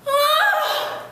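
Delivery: sudden high pained cry, loud; a sharp, high cry of pain; anguished cry. Acted. Cry at the coyotes' threat.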